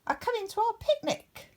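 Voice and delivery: high excited Winnie voice; excited witch. Acted. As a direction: announce the rabbits with glee. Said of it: "said Winnie".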